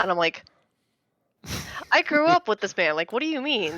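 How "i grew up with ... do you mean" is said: high, loud retold disbelief; incredulous. Wife replaying shock about her own dad, incredulous. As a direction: protest in high-pitched bafflement.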